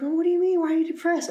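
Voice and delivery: high-pitched imitation of a worried partner; concerned girlfriend. Girlfriend asking why he is depressed, concerned. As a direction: mimic her questioning, pitched well up.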